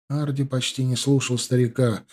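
ordinary delivery

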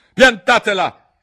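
very high, very loud; angry shout. Acted. La regina grida ai giardinieri, furiosa. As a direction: yell at them to stop. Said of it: "gridò la regina".

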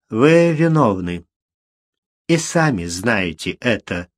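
composed judge, controlled and final; even voice. A narrator's reading. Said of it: "ровным голосом сказал он".

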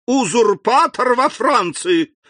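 high, loud, slow outcry; alarmed cry. A narrator's reading. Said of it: "закричал он". (король в ужасе кричит)

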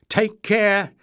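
calling up from below; called out. A narrator's reading. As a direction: shout it up the tunnel. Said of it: "Leif's muffled voice floated upwards".

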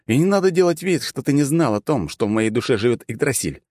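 high-pitched indignant accusation; protest, starting high and easing down. A narrator's reading. Hero accusing god of pretending not to know, indignant. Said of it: "возмутился я".

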